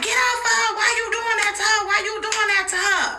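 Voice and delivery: shrill-pitched high yell to break up a fight. Retold or imitated. Friend screaming at him to stop the fight, high yell. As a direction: scream at him to get off her, high and fast.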